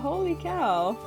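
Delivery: ordinary delivery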